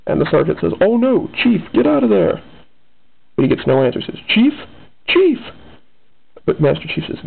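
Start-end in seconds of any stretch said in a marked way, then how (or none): 0.8-2.4 urgent, higher-pitched warning; acted alarm, pitch jumping up. Sergeant urgently warns Chief of beam. 4.3-5.4 second call jumps much higher; acted worried call, building to a cry; calling out in alarm. Sergeant calls, gets no answer.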